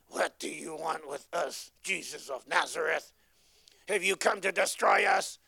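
growing demon voice, ending in a shout; Chinese demon. Acted. Demon confronts Jesus in the synagogue, building to a shouted cry. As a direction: snarl at Jesus, rising to a shout. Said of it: "that's how a Chinese demon sounds like".

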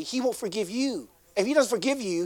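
ordinary talk

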